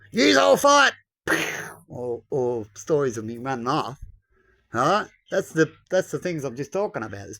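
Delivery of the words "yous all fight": high barked order; mock dictator command. Mocking a leader who runs off, shouting orders. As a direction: yell it like a cowardly tyrant. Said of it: "like a Hitler".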